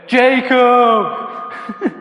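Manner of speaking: booming, drawn-out call from on high; voice from heaven. Acted. Prankster calling down to Jacob as God, booming voice from heaven. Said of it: "hears this voice from heaven go".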